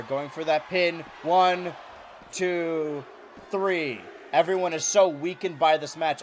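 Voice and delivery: normal talking voice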